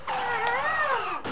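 loud high yelp; startled yell. Acted. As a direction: cry out in alarm. Cut off by rival car.

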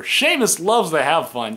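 high, slow, loud corny commentator; drawn-out cheesy announcer line; mock-cheery play-by-play; mocking Michael Cole. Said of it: "he'd be like".

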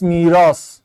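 usual speaking voice

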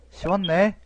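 high, loud amazed question; surprised. Real, not acted. Gamer realizing the solution was easy, high surprised question. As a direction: sound stunned at how easy it was.

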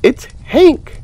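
high, loud startled cry; high startled shout of recognition; surprised exclaim. Acted. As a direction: blurt the name in shock. Bailey spotting the ink cloud.

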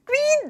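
very high swooning squeal; fangirl squeal. Real, not acted. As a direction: squeal with delight at the hug. Swooning at hug scene. (กรี๊ดเสียงสูงด้วยความฟิน)

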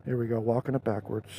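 ordinary delivery, nothing marked

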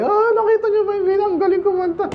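high, fast fake-excited hype voice; hype man. Preacher mimics the Beatles' paid hype men, high gushing. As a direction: gush like a paid fan.

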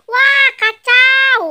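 a high, loud, very slow wail of dismay; a kid groaning at the trouble ahead. Acted. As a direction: stretch out the despair. Panic about danger.

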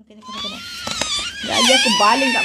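high laughing yell with a fast threat; laughing mock threat shouted at nosy commenters. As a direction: shriek it half-laughing as a joke threat. Mock threat of throwing stones.

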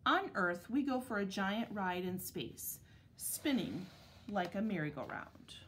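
measured picture-book reading with clear pauses; unhurried, carefully articulated storybook narration; read-aloud to children. Acted. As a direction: read it to second graders, phrase by phrase. Reads picture book to second graders.

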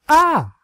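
high, loud yelp of a smack; sharp cry. Acted. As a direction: yelp as the paddle lands. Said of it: "she cried out".

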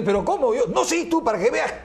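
high, loud, baffled protest; shocked witch doctor explains fanning; astonished witch doctor. Acted.

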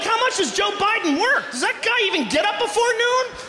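high-pitched exasperated rant; incredulous rant. Retold or imitated. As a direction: squeal it like the idea is absurd. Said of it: "I'd be like".

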